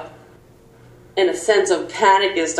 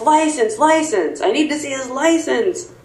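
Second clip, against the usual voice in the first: higher-pitched imitation of a demanding cop; mock yell; 7 semitones higher than usual. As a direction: imitate an officer's yell without much volume. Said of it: "So he yells".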